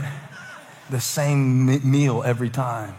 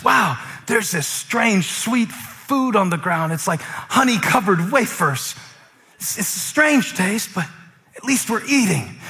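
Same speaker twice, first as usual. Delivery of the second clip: slow, higher-pitched amazement; curious wonder; 8 semitones higher, 4 dB louder, slower than usual. Acted. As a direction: act out marvelling at a strange discovery. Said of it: "what was it like the first day".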